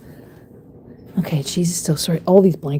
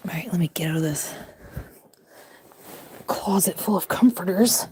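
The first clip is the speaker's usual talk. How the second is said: whisper; 4 dB quieter than usual. Real, not acted. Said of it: "whispering to sleeping pets".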